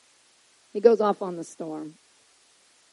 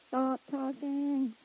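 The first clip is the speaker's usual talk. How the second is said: slow, drawn-out, softer order; mock scolding; 4 semitones higher, 6 dB quieter, much slower than usual. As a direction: stretch it out at a higher pitch.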